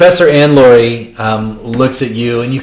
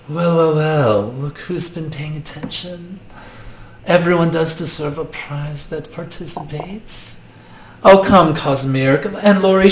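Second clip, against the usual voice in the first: soft, sultry seductress voice; Jessica Rabbit voice; 4 semitones higher, 7 dB quieter than usual. Acted. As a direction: purr it like a femme fatale. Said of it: "she is full on Jessica Rabbit".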